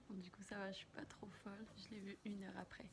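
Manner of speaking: soft hushed whisper. Real, not acted. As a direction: keep it to a whisper. Chuchote encore, commentaire tardif.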